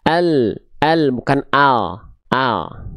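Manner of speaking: "al" said slowly, tongue tip only, light and thin; teacher slowly models "al" for students. Shown as a demonstration. Slow thin Arabic "al". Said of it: "ketika kita baca".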